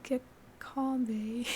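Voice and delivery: Aussie attempt that slides into British; slow, botched Australian accent, rising at the end; failed Australian accent. Shown as a demonstration. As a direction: try Australian, land nearer British. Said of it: "No, that's, like, British".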